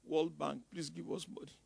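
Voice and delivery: soft, whining mock plea to World Bank; mock begging. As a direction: play a meek beggar nation, half-joking. Mocking a nation begging abroad.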